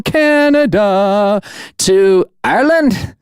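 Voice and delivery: a quick, hammed-up Irish lilt that drifts toward Scottish; tossing off a bad Irish accent in a listener shout-out; mock Irish accent. Podcast host shouting out Irish listeners in a mock Irish accent. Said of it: "I know that's probably Scottish".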